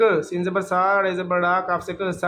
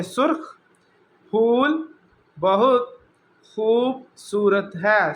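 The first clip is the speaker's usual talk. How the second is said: "سرخ پھول بہت خوبصورت ہے" read slowly, a pause after each word; 3 semitones higher, slower than usual. Shown as a demonstration. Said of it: "میں صرف اس کی ایسے ہی ریڈنگ کر دیتا ہوں". (آہستہ آہستہ ایک ایک لفظ الگ پڑھنا)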